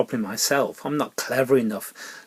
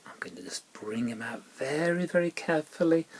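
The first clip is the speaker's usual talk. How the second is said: hushed whisper, coaxing the puppet out; 9 dB quieter, faster than usual. Acted. Presenter coaxing the shy puppet out, in a whisper. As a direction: whisper so as not to startle him.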